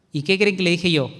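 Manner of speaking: ordinary talk, nothing marked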